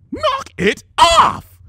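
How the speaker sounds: high, loud, slow furious roar. A narrator's reading. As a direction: bellow it at the door. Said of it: "the Lavender Unicorn roared".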